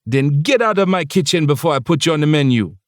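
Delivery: high Jamaican scold, half joking; bossy Jamaican accent. Acted. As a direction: shoo him out like a bossy Jamaican chef. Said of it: "lilting and bossy".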